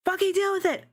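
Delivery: high, sassy defiance; defiant. Real, not acted. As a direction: toss it off with attitude. Defiant repeat, pitched way up.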